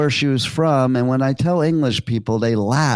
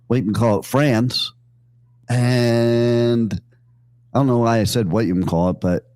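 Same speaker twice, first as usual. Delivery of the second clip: ordinary talk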